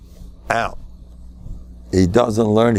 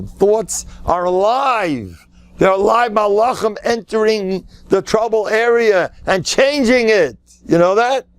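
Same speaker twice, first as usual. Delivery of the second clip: preaching; 9 semitones higher than usual. Acted. Rabbi preaching to listeners that thoughts are alive, high and fervent. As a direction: lift the crowd higher with each phrase.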